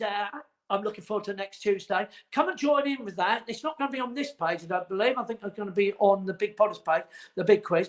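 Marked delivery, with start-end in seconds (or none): none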